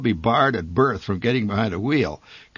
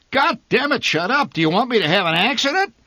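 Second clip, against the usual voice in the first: much higher, louder furious roar, fast at the end; furious bellow; 13 semitones higher, 5 dB louder than usual. A narrator's reading. Father bellowing at wife while driving, furious. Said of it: "My father bellowed".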